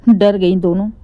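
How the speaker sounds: ordinary delivery, nothing marked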